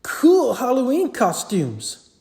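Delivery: put-on boy voice. A narrator's reading. Boy staring at the monsters, impressed kid.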